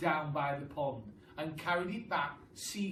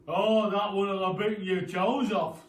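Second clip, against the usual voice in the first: loud put-on voice teasing his wife; sly Mister Twit; 6 semitones higher, 12 dB louder than usual. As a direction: spin the scary tale with relish. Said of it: "said Mister Twit".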